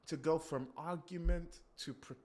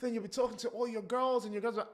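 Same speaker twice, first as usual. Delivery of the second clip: usual speaking voice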